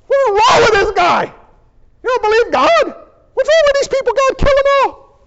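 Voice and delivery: high-pitched, comic outraged yelling; exasperated rant. Acted. Gabriel complains to God about these people, hammed-up exasperation.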